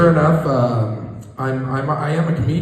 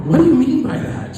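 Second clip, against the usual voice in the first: startled reply; 4 dB louder than usual. Retold or imitated. Own startled reply to wife.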